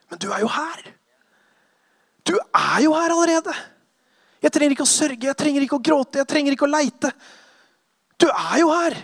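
grief lifting into joy, growing to a shout; joyful revelation, building. Acted. Mourner realizing Jesus is here, rising to joy.

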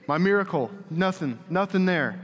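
normal talking voice

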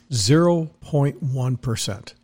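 slowed-down spelling-out of "Zero point one percent", each word set apart; slow and deliberate. Shown as a demonstration. Host drilling the number into listeners, slow and deliberate. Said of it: "I'll do it in fractions so that you get it".

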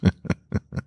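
breathy, unvoiced sinister laugh; a quiet, pleased villain's laugh; hushed dark chuckle; soft evil chuckle.

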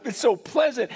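ordinary delivery

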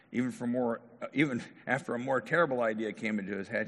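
normal talking voice

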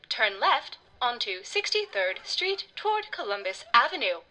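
slow, even robotic navigation voice; GPS voice. A narrator's reading. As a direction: speak like a maps app. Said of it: "said Miss Googleheimer".

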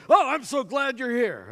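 high, loud gushing welcome; hammed-up eager greeting; over-enthusiastic. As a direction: imitate a team captain gushing too much. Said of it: "a little too much enthusiasm".

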